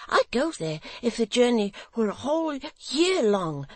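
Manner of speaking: put-on high, loud, eager simple young man; Barnaby's voice. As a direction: pour it out high and earnest, longing.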